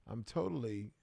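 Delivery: ordinary talk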